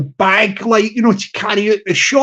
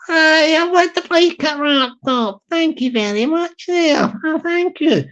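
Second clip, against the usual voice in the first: a light put-on voice for the small customer; 5 semitones higher than usual. Wee guy customer voiced in story.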